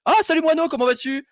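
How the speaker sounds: high-pitched hello to a friend; delighted greeting. Real, not acted. Greets a friend joining the chat.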